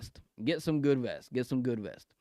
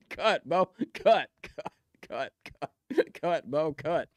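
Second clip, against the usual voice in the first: normal talking voice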